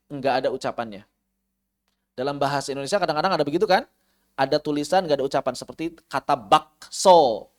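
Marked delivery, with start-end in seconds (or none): none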